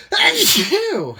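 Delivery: loud real sneeze; big explosive sneeze; sneezing. Real, not acted. Sneezing fit continues.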